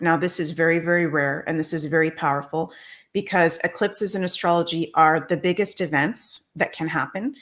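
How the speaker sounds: ordinary delivery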